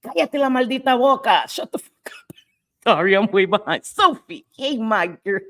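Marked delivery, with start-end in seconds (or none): 0.0-1.4 higher, punchier Spanish retort, lightly acted; quoting her friend again for chat, higher and punchier; high sassy quote. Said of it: "That's what you say". 1.5-2.4 whispered aside; whispered translation. Shown as a demonstration. Streamer whispering the English meaning to chat. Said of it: "That's what you say".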